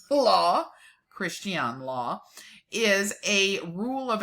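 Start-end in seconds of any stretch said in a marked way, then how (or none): 0.0-0.7 breaks into a laugh, higher and louder, on the word; can't keep a straight face on law. Real, not acted. Laughs mid-word while reading.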